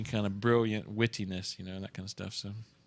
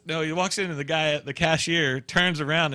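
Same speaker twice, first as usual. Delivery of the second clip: ordinary talk, nothing marked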